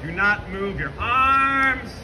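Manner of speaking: usual speaking voice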